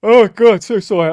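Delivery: talking through a big loud yawn. Real, not acted. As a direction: yawn stretches the words, high and loud. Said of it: "I'm yawning".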